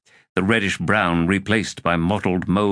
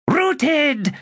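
much higher triumphant crow. A narrator's reading. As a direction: crow it out gleefully. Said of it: "crowed Telerast".

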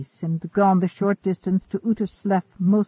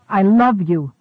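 insistent declaration; 7 dB louder than usual. A narrator's reading. He insists despite her refusal.

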